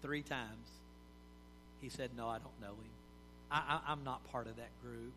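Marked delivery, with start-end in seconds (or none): 2.2-5.1 softer, stammering frightened denial; fearful denial. Acted. Peter's third denial, spoken softly out of fear. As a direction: say it small, scared of being exposed. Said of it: "Out of fear".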